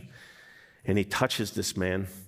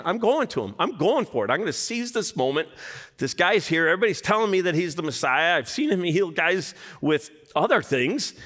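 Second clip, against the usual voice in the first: high, loud eager resolve; eager leper; 8 semitones higher, 3 dB louder than usual. Acted. Leper talking himself into going to Jesus, eager and high. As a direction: pump yourself up to go for it.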